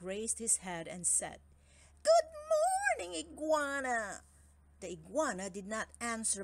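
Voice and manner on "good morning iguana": big snake voice. Acted. Big snake greets iguana.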